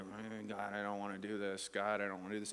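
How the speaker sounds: low, soft muttered complaint; grumbling. Acted. Preacher acts a reluctant host walking away, grumbling. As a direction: grumble it under your breath. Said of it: "muttering under our breath".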